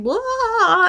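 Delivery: a high, giddy burst of nonsense; excited blurt. Real, not acted. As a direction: blurt it out, too excited for words. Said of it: "I'm so excited about these books".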